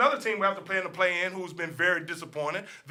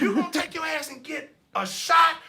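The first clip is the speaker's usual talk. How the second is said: hammed-up loud threat, higher and louder; mock threat; 5 semitones higher, 8 dB louder than usual. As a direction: bark it like a mock gangster.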